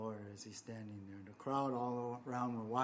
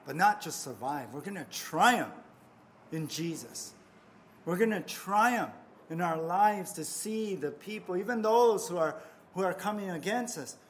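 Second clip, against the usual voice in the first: rousing preaching; 4 semitones higher, 7 dB louder than usual. Real, not acted. Sermon climax, rousing crowd.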